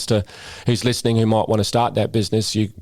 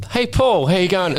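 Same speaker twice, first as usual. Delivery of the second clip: calling out; 9 semitones higher than usual. Retold or imitated. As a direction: hail him cheerily from the doorway. Tradie calls out across shop.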